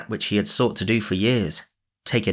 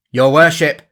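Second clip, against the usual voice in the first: louder and higher call; man calling to the sheriff, loud; cried out; 6 semitones higher, 9 dB louder than usual. A narrator's reading. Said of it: "cried he".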